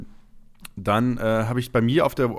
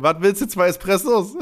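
loud, startled waitress, half laughing; surprised waitress; 6 semitones higher, 5 dB louder than usual. Retold or imitated. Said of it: "Und sie dreht sich um".

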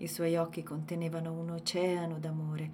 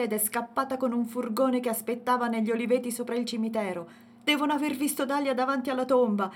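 loud, higher furious ranting; thundering rage; 5 semitones higher, 6 dB louder than usual. A narrator's reading. Yeya cursing the attackers to his family, thundering anger. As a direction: rant like a man boiling over. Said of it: "tuonavano come la sua voce".

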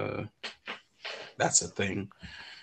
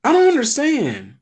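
baffled; 13 semitones higher, 4 dB louder than usual. Real, not acted. Confused by third museum entrance.

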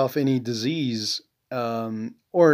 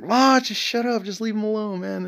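mock exasperated son; 8 semitones higher than usual. Son retells his reply to mom.

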